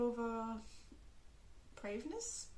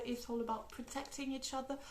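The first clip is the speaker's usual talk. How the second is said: normal talking voice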